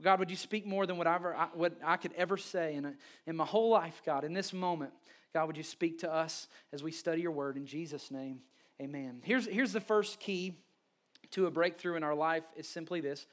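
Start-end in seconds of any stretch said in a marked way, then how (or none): 0.0-9.2 quiet, hushed opening prayer; soft prayer. Real, not acted. Pastor praying softly over the congregation before preaching. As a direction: pray gently over the room.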